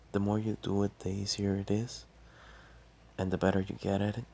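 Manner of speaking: ordinary talk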